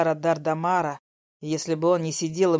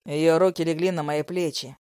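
usual speaking voice

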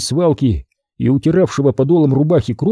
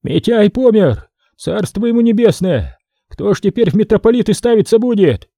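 higher, urgent cry; agitated outburst; 8 semitones higher than usual. A narrator's reading. Archimandrite bursts onto deck announcing death, higher excited cry. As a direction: burst out with the alarming news.

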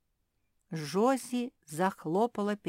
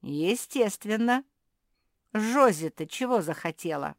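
sarcastic explaining; 3 semitones higher, 7 dB louder than usual. A narrator's reading. Basil blames Jozi for the missing blue things, sarcastically. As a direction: lay it out with mock congratulation. Basil mocks after laughing.

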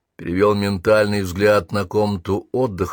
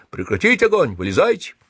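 mild shouted command; 8 semitones higher than usual. A narrator's reading. Sergeant to both sides in the firefight, mild shouted command. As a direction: jump high, then drop to usual pitch. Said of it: "крикнул".

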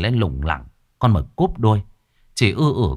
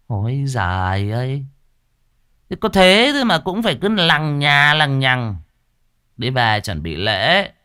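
put-on high, dismissive grumbling old man; weary master; 6 semitones higher than usual. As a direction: wave off the fuss as nothing. Master Cẩn sighing dismissively.

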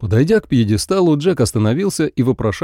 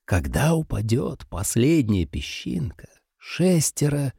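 softer, slow ominous hush; sinister whisper; 5 dB quieter, slower than usual. Acted. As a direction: tell it like a ghost story. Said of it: "начал Джек зловещим шёпотом".

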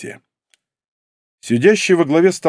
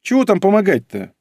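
whining; 8 semitones higher than usual. Acted. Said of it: "заныла рыжая".

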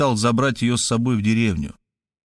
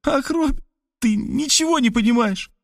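hurt, tearful. A narrator's reading. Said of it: "обиженно приговаривая".

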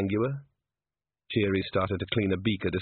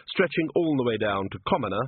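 ordinary delivery, nothing marked

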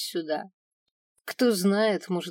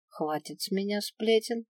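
plain reading voice

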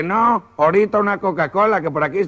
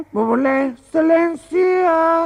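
high, slow, drawn-out excelencia; fawning pilot; 10 semitones higher, slower than usual. Acted. Pilot answers the boss in a high, fawning drawl. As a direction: grovel with a stretched excelencia.

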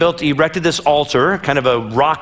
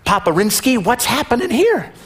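put-on higher, casual jokey kid; lightly voiced hip kid; 5 semitones higher than usual. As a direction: toss it off like a cool teen. Isaac voiced, jokey boy.

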